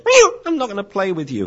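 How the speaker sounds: puppy protesting a hard bite in a high yelp; hurt puppy yelp. Retold or imitated. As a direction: squeal it like a bitten puppy. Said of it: "one puppy goes".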